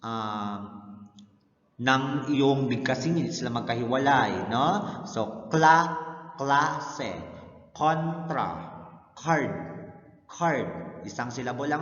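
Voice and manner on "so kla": kla said higher and louder as one cluster; teacher demo of kla; cluster sound demo. Shown as a demonstration. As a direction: isolate kla for the class. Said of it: "magkasama ang K L".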